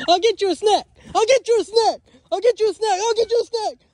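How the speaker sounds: repeated high panicky shouts, voice climbing; frantic high pleading. Real, not acted. Chased by the turkey Cornholio, frantic high pleading.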